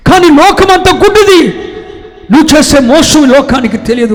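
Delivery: loud, high, impassioned sermon; preacher in full flight; fiery preaching. Acted. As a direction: thunder it at the congregation.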